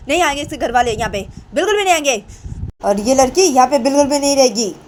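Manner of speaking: put-on high, loud scolding old woman's voice; angry grandmother. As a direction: shriek it like a furious old grandmother. (गुस्से में चिल्लाती दादी की आवाज़)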